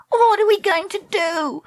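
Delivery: very high-pitched wail of panic; panicked cry. A narrator's reading. Treacle as eels attack the boat, panicked cry. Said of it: "Treacle cried".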